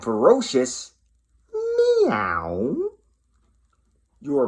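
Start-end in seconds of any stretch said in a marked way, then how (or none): 1.5-2.9 high, tiny kitten meow; cute meow. Acted. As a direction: meow like a little kitty. Finds something wild and ferocious.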